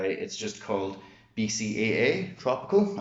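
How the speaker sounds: ordinary delivery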